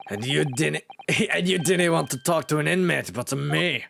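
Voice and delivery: lilting Scottish governor; Scottish accent. Acted. Governor questioning a visitor about inmates, Scottish accent. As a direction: put on a Scots brogue. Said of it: "Irish slash Scottish".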